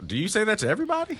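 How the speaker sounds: high, fast incredulous retort. Retold or imitated. Dad retelling his retort to the caseworker, high and fast. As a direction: fire it back in disbelief. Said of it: "And I was like".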